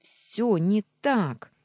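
high, loud, slow protest; desperate yell. A narrator's reading. Heroine between two angry men, desperate yell. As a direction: cry out each word. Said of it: "завопила я".

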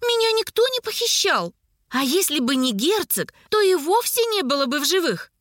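high-pitched, indignant niece; wailing protest. A narrator's reading. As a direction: argue back, pitch leaping up. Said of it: "заголосила из-за плеча герцога рыжеволосая девица".

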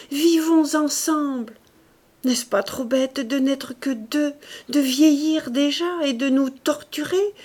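urgent, tender plea rising high; pleading lover. A narrator's reading. Woman urging her defeated lover to live, pleading. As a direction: coax him back to life. She begs him to live.

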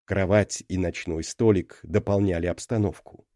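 plain reading voice

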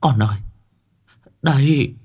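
high, breaking cry of recognition; bursting into tears. Acted. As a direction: sob out that it's really her son. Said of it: "đột nhiên òa khóc".

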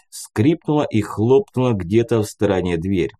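ordinary delivery

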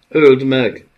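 shout. A narrator's reading. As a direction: yell the command. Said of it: "kiáltotta Felice".

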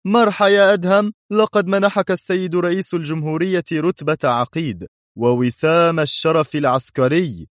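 high, merry announcement; cheerful ambassador calling out congratulations; merry cry. A narrator's reading. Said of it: "وصاح في مرح".

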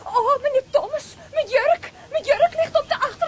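dismayed cry. Acted. Her dress left in stolen car.

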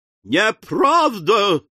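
high, drawn-out outraged cry; shrieked protest, moderately loud; indignant wail. A narrator's reading. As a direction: wail the denial, stretching it out. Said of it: "завопила в ответ незамужняя тётушка".

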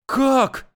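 high frightened exclamation; frightened cry. Acted. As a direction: blurt it out in fright. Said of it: "испуганно воскликнула Света".

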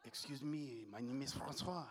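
put-on low, soft French accent. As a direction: introduce yourself as a smooth French seducer, French accent. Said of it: "with a fucking French accent".

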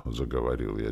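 ordinary delivery

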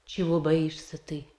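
normal talking voice

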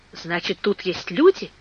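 ordinary delivery

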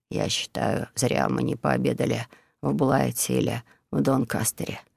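low, soft, hoarse grumble; hoarse male voice. A narrator's reading. Monk grumbling about missed dinner, hoarse and soft. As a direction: rasp it out half-heard. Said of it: "слышался хриплый мужской голос".

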